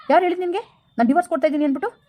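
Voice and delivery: high, fast and indignant; angry outburst. Acted. Husband confronts his friend over the divorce rumour, high, fast and indignant. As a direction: fire it straight back at him, outraged.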